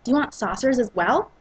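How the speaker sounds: ordinary delivery, nothing marked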